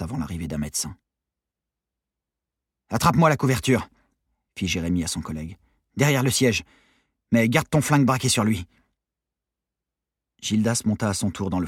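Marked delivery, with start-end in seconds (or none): 2.9-3.9 raised, higher voice; urgent order. A narrator's reading. Officer to his colleague in the van, urgent order. 6.0-8.7 higher, louder instructions; urgent order. A narrator's reading. Officer tells colleague to stay on guard, urgent order.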